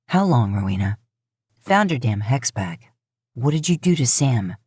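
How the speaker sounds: low, impatient brother demanding answers; gruff hunter. Acted. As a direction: press her, low and hard. Dean voiced, lower.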